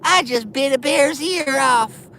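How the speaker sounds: very high, squeaky cartoonish voice; tiny high voice, tough-guy words; Mike Tyson. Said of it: "talk like Mike Tyson".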